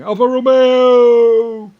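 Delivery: drawn-out, very loud, high war whoop; mock battle cry. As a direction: bellow it like a crazed fanatic. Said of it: "in Guantanamo Bay going".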